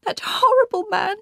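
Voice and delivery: very high-pitched outburst; high exclamation of loathing; distressed cry. A narrator's reading. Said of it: "she cried".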